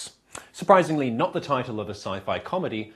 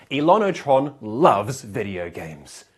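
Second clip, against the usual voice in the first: usual speaking voice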